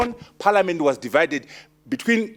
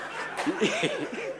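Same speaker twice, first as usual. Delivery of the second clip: breathy laugh; 9 dB quieter than usual. Real, not acted. Laughs at own joke.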